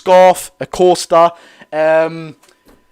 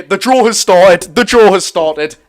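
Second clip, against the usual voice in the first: urgent announcement; 2 semitones higher, 6 dB louder than usual. Real, not acted.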